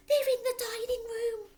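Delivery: mother panicking about burglars; mock hiss. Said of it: "hissed the mother".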